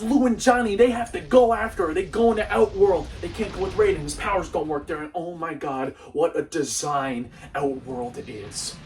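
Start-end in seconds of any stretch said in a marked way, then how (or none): none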